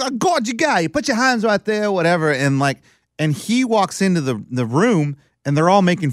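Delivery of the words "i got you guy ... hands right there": mock British coach accent, pitched up. A hint of British accent. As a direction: lightly imitate a British coach. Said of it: "he talks like this".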